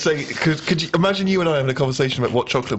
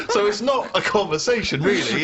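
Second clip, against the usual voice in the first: laughing; 5 semitones higher than usual. Real, not acted. Laughing at dull marriage.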